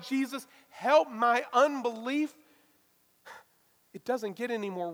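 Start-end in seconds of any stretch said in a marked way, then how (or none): 0.0-2.3 desperate cry. Retold or imitated. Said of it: "crying out".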